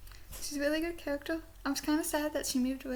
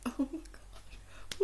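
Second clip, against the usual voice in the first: breathless gasp; 8 dB quieter, much slower than usual. Retold or imitated. Reacting to the accent scene.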